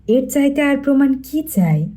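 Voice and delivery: plain reading voice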